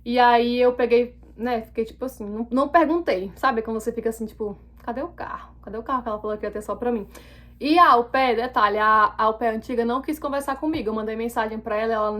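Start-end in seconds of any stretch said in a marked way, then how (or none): 4.8-7.1 voiced thought under the breath; muttered inner thought. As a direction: mutter it as an unasked question, much softer. Silent thought she never asked aloud.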